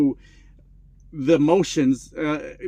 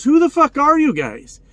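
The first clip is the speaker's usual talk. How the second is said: much higher, louder demand; angry challenge; 9 semitones higher, 4 dB louder than usual. Retold or imitated. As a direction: snap back at the intruders. Roommate confronts the intruders.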